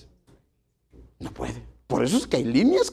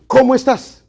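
loud, sharp, much higher demand; harsh tone; 9 semitones higher, 12 dB louder than usual. Shown as a demonstration. Pastor showing the same greeting twisted, loud and harsh. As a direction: bark the question as if annoyed. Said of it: "Es la misma palabra, pero el tono cambia".

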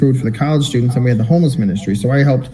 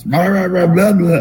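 slurred, stumbling nonsense babble, pitched up; drunk mumbling; 5 semitones higher than usual. Retold or imitated. Drunk man interrupting the church service, mock slurred babble. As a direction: mimic a drunk man tripping over his words. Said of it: "just stumbling over his words".

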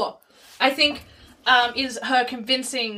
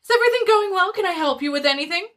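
higher, louder deadpan shop-assistant patter; chirpy but empty retail script; customer service voice; 7 semitones higher, 3 dB louder, faster than usual. Shown as a demonstration. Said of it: "my customer service voice with completely deadpan face".

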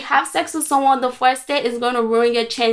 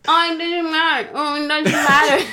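mocking dismissive voice; 4 semitones higher than usual. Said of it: "you guys keep saying".

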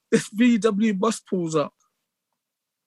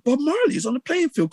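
disbelief; 7 semitones higher than usual. Retold or imitated. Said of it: "And I was like".

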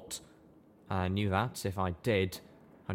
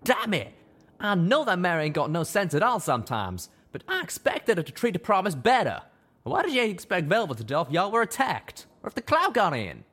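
high, loud, accusing tirade; furious rant; 11 semitones higher, 6 dB louder than usual. Acted. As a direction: keep hammering the blame. Calamity ranting at Steelhooves.

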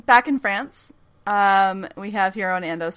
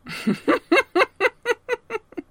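big laugh; 14 semitones higher than usual. Real, not acted. Laughing at own joke.

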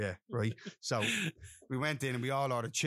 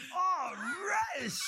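yell; slower than usual. Retold or imitated. Ryan yelling about lettuce.

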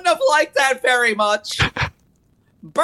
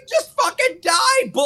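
exasperated yell; 7 semitones higher than usual. Real, not acted. Yelling at cursed book.

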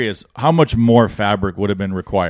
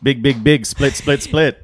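mock Chinese accent; 2 semitones higher, slower than usual. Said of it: "do it in a Chinese accent".

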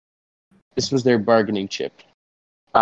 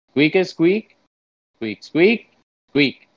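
higher, rising squirrel squeaks; squirrel talk; 6 semitones higher, slower than usual. Pretend. Interpreter and squirrel confer during an interview, squirrel talk. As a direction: chatter squeaks as if they were words.

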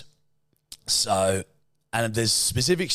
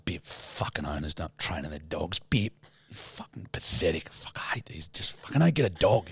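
low furious muttering under the breath, half whispered; seething to himself at the checkout, barely voiced; muttered angry rant; 4 semitones lower, 4 dB quieter than usual. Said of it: "she can tell I'm fuming".

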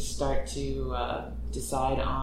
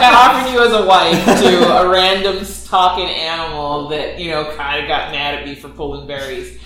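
mock mother confession; 2 semitones higher, 12 dB louder than usual. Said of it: "it's like".

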